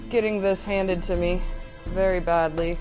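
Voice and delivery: ordinary talk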